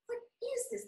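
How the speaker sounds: high, loud amazed question; astonished cry at the raven. Retold or imitated. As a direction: gasp it out in wonder. Another raven overhead.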